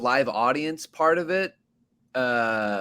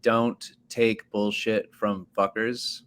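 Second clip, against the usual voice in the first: slow, deliberate, each word spelled out for the host; careful slow title; 2 semitones lower, slower than usual. Shown as a demonstration. Telling the club host how to announce the podcast, slow and deliberate. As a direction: say the title slowly so they get it right. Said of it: "I have to say it like this".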